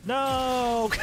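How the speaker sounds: high startled shout of "No!". Real, not acted. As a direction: yell it out in shock. Sudden death on screen.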